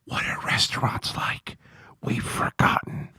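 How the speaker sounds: unvoiced whisper, slow and secretive; mock whisper. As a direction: whisper like asking about a lost world. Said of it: "They walk up to you and like".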